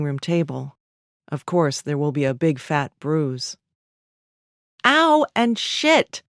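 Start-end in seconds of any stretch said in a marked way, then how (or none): 4.8-6.2 high, slow, drawn-out cry of pain; raised-voice whining swear; louder pained cursing. A narrator's reading. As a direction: curse aloud over a bumped hip. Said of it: "she says a little".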